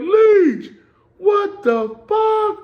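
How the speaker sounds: very high, loud, drawn-out shout; shouted greeting. Retold or imitated. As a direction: holler across the yard in disbelief. Guards shouting on seeing him.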